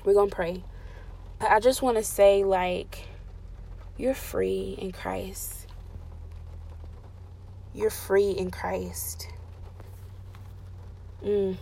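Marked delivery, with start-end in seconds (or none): 4.0-11.6 slow, soft, hushed promise; tender reassurance. Real, not acted. Pastor softly assuring hurting listeners they are free. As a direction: breathe it gently to them, almost whispering.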